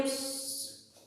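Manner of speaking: soft voiceless spray sound; quiet "pssh" of a shower; shower hiss imitation. Shown as a demonstration. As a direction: imitate running shower water with a hushed hiss. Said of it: "do chuveiro".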